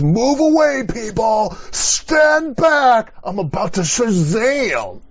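a huge, high, hammed-up shout; mock movie-hero shout. Pastor mocking a movie-style Jesus, hammed-up shout. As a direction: bellow like a cheesy movie wizard about to cast a spell. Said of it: "he shouts to the heavens".

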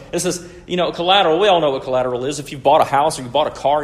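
a preacher's fervent push; emphatic preaching. Real, not acted. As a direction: lean in hard on collateral. Preacher stressing collateral to congregation.

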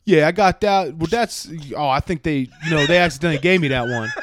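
loud, flustered excuse through a laugh; laughing bluff. Retold or imitated. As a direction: cover the lie with a chuckle. Fake soldier caught out, laughing.

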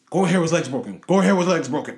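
high, loud announcer yell; imitating a screaming announcer. Announcer yelling the injury to viewers, loud and high. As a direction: shout it like the broadcaster breaking the news. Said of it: "you hear Reggie Miller screaming".

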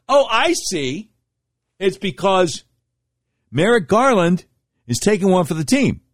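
high, mocking sarcasm; sarcastic. Real, not acted. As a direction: sneer out the obvious answer. Host mocking the professor's puzzlement.

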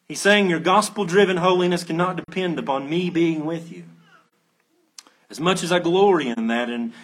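ordinary talk

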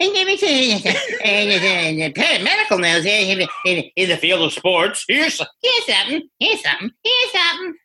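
high, laughing talk-show host voice; Jay Leno impression. A comic mocking Leno's monologue, high and laughing. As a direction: ham up the rambling late-night host. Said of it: "did I go back to the, uh, impression?".

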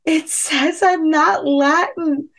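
high, drawn-out tearful wail, played back; mock bawling. Crying to husband on the phone, mock bawling. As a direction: wail it slowly, imitating your own distress. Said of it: "I'm bawling and I said".

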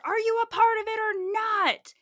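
full-force high shouted challenge; very loud, high imitation of a preacher's yell; yelling pastor. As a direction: yell it at the crowd like a fired-up pastor. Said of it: "this is what she's yelling".

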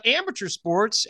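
normal talking voice

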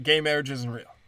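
ordinary talk

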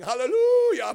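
high, loud drawn-out howl; werewolf howl. Pretend. Hairy minister worshipping on full moons, mock howling. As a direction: howl it playfully like a wolf. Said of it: "On full moons, I worship the Lord by going".

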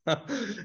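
a short, high burst of laughter. Real, not acted. Laughing at the nuns' reaction.

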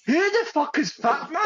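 very high, baffled mock Scottish; laughing impression of a Scottish woman; Angie. Retelling Angie asking about Fat Man, very high Scottish accent. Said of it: "I can't do it Scottish, but she's like".

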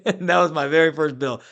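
ordinary talk

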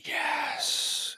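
long, breathy whisper imitating a spirit; ghostly male voice. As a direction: whisper it as a voice cutting in on tape. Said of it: "the voice cuts over mine".

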